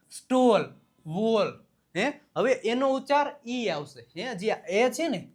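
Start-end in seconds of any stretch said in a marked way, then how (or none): 0.1-1.6 સ્ટોલ and વોલ with a held, rounded oh; long o sound. Shown as a demonstration. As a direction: say સ્ટોલ, વોલ one by one, vowel drawn out. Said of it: "એનો ઉચ્ચાર ઓ આવ્યો". (સ્ટોલ, વોલ માં લાંબો ઓ)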